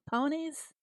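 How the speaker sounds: Ponies? said as PO-nees, for peonies; mock his PO-nees; husband's peonies. Streamer imitating her husband's peonies for chat. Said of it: "what does he call them".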